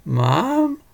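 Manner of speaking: high, frightened little call for mom; whimpering. Acted. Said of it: "Molly whimpered".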